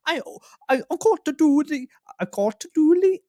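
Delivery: high, loud mock-brother squeak; squeaky brother voice. Imitating the brother busy with chores, squeaky and high. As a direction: practise a squeaky put-on voice. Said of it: "I do his voice for him".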